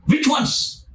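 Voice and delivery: high, eager repeated question; eager excitement. Retold or imitated. Rich man eagerly pressing Jesus, high and keen. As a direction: pitch it up like he can't wait. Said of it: "In the tone he asked".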